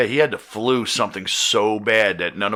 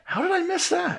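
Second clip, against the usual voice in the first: surprised; 5 dB quieter than usual. Real, not acted. Learns of a missed headbutt.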